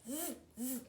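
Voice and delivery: mocking monkey buzz; monkey chatter. A narrator's reading. Monkeys mock the peddler, buzzing monkey chatter. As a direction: buzz back at him like a monkey.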